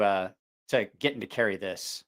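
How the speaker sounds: usual speaking voice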